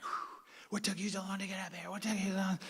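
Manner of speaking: high, soft puffed-out voice; mock winded. Runners at the top to Bedouin, mock winded. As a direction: imitate runners gasping out a taunt.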